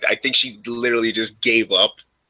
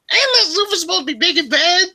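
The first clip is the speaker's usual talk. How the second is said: high mom impression. Son imitating his mom's gripe about Affleck, high and squeaky. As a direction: stretch it out in a high mocking falsetto. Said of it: "And like".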